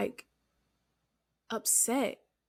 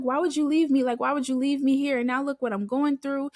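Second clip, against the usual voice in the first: retold angry outburst, higher and louder; mildly heated, hurried pleading questions; 5 semitones higher, 5 dB louder than usual. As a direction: reproach the one who left you. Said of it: "I got angry and I was like".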